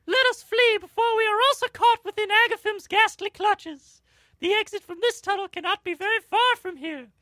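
high tearful plea with a sob; a touch of sob in the voice; slight sob. Acted. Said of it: "with a slight sob to her voice".

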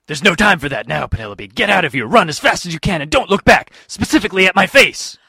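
loud heroic orders; loud, commanding hero. Acted. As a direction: bark it like a superhero. Hero orders girl to flee.

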